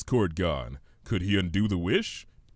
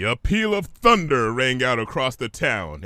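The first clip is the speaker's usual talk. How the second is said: ordinary delivery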